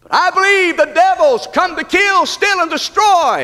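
high, ringing pulpit cry; full-force preaching. Real, not acted. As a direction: declare it with fierce emphasis.

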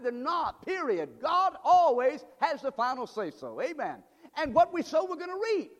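high, driving sermon delivery; preaching at full force. Real, not acted. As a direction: hammer it home to the congregation.

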